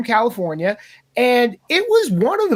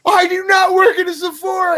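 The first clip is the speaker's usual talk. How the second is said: mock indignant lady; 9 semitones higher, 3 dB louder than usual. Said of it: "And she just goes".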